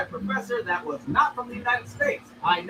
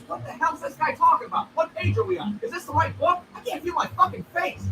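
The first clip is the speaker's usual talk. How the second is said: baffled student; 6 semitones higher than usual. Retold or imitated.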